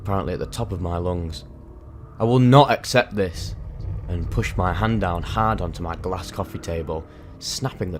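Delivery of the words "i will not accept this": higher and louder, defiant; defiant yell of refusal; retold defiant scream. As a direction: shout it back at the voices, refusing to give in. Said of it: "I screamed out to myself".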